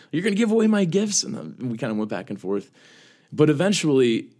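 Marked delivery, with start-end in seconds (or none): 0.1-1.2 high, louder hurt mom voice; upset mom. Retold or imitated. Son quoting his upset mother, high and louder. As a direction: play Mom's outrage, pitched way up. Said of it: "she got really upset".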